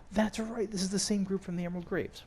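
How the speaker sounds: gasp, then a high excited realization; sudden excited discovery; gasp of recognition. Real, not acted. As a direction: it all clicks at once. Recognizing the group.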